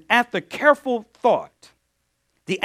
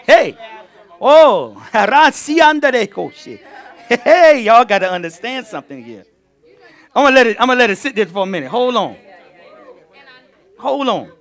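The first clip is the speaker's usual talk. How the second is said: excited outburst; 4 semitones higher, 9 dB louder than usual. Real, not acted. Preacher erupts after reading verse.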